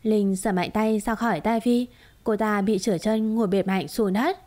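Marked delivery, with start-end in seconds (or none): none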